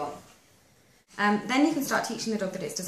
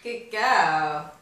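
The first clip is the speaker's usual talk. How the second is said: praising the dog; 8 dB louder than usual. Real, not acted. Trainer praising her dog after the treat, fond louder praise. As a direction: reward the dog with your voice.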